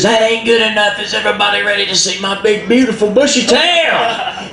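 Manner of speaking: high, calling out to the crowd; showman Possum. Acted. Possum hyping the animals from the stage, high showman call. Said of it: "And he says".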